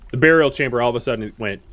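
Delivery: normal talking voice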